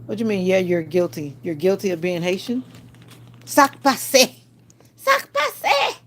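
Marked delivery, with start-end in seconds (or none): 3.4-6.0 high, loud squeaky call; squeaky put-on holler; playful Creole greeting. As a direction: shout it up high, higher the second time.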